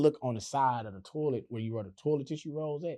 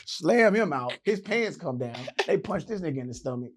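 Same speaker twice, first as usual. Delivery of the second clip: usual speaking voice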